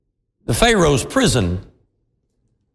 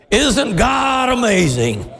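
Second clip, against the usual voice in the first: slow, higher, shouted "Isn't God amazing?"; preacher's shout; 5 semitones higher, slower than usual. Acted. Repeated as shout.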